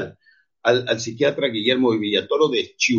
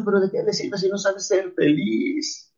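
usual speaking voice

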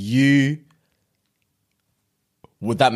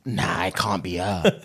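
slow, drawn-out disbelief; disbelieving inner voice; 3 semitones lower, 4 dB quieter, slower than usual. Retold or imitated. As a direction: think it slowly, doubting. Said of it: "I was thinking".

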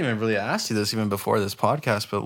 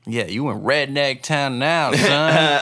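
put-on loud, drawn-out Southern accent, building; 4 semitones higher, 5 dB louder, slower than usual. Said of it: "I do a southern accent".

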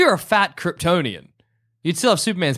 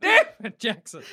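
exasperated Flash; 4 semitones higher than usual. Pretend. Flash cursing villain.

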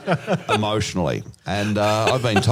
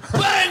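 mock bang. Acting out the seat slam.

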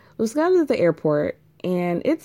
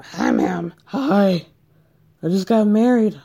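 mock Christiana; 5 dB louder than usual. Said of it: "Christiana calls her mom".

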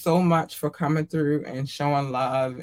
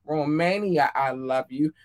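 normal talking voice

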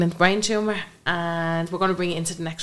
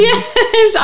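a burst of laughter into yeah, much higher and louder; laughing yeah; 12 dB louder than usual. Real, not acted. Laughs after the dinner story.

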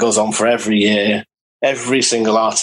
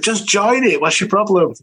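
exasperated; 11 semitones higher than usual. Retold or imitated. Telling mate to join Facebook.

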